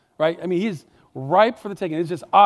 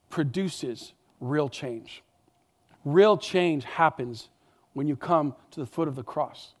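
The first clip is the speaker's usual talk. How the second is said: pastor driving home the point; slow emphatic close; 3 semitones lower than usual. Real, not acted. Slows for key point.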